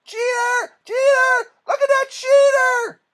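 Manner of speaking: high-pitched, full-volume screamed accusation; outraged boy screaming at a rival; furious screaming. Acted. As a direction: scream it out, stretching each cry. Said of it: "started screaming".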